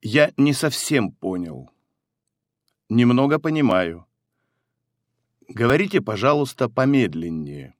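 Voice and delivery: ordinary talk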